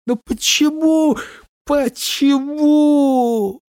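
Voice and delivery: high, drawn-out despairing why; despairing wail. A narrator's reading. Helpless despair after howl.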